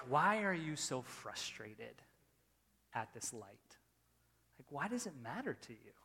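soft, low, gentle questioning; calming. Retold or imitated. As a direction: gently talk someone down, as God would. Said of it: "he started to calm me down".

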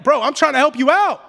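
high, loud, incredulous pleading; hammed-up exasperated outburst; exasperated Pilate. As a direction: shout in disbelief at someone refusing your help. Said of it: "that's the attitude of Pilate".

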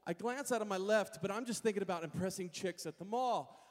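usual speaking voice

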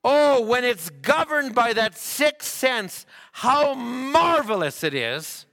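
high, loud, drawn-out exclamation; impassioned preaching. Acted. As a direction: preach it out in wonder, stretching the words. Preaches at full force.

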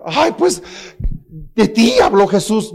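high, slowed, whining put-on voice; mock whiny crybaby. Preacher mocks the tearful believer in a high, whiny voice. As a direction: ham up a self-pitying crier. Mocking crybaby's self-flattery.

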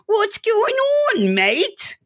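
mock Aussie greeting, stretched out and high; Australian accent. Podcaster greeting Deadpool in a hammed-up Australian accent. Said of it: "his Australian accent".

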